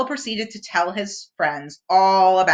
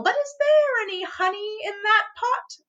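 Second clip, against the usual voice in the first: Pooh shouting; 13 semitones higher than usual. A narrator's reading. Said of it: "Pooh shouted over the howling wind".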